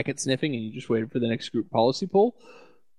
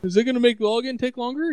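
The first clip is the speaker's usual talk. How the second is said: mocking worried coworker; 9 semitones higher, 3 dB louder than usual.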